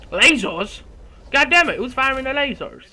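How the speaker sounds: high, loud indignant cry; startled, high-pitched gamer shout; exasperated outburst. Real, not acted. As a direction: yell out in alarmed annoyance. Startled outburst at lasers in game.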